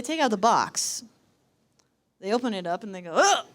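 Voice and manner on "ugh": high, loud mock "ugh"; disgusted grunt. Security opening the box, loud acted disgust. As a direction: recoil with a loud yelp of disgust.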